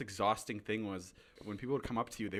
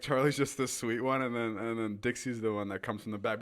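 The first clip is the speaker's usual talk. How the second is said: normal talking voice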